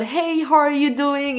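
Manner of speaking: assured friends. Retold or imitated. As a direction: mimic friends now at ease in English, lightly put on. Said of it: "ils étaient en mode". (amis devenus à l'aise, salut américain détendu, plus aigu et lent)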